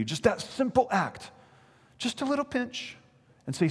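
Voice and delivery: high, wheedling coax; a hint of a persuading Roman official. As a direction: sweet-talk him into one small pinch. Officials coaxing Polycarp to sacrifice.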